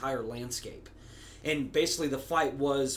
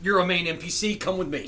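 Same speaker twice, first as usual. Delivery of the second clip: bossy organizer; 4 semitones higher, 6 dB louder than usual. Retold or imitated. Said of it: "Tab will totally grab you and be like".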